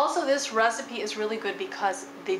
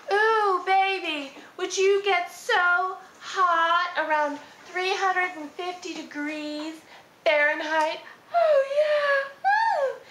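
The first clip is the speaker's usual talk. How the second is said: high, slow sultry purr to the oven; breathy vintage purr; 7 semitones higher, slower than usual. Acted. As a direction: flirt with the oven, seductive and campy. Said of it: "Say it with me".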